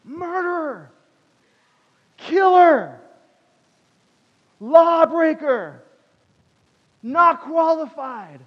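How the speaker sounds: high, drawn-out heckling shouts; yelled accusations. Retold or imitated. Accusing voices going in Moses' head, yelled condemnations. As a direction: hurl each word like an accuser, with pauses between. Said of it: "same way that we were yelling at these guys".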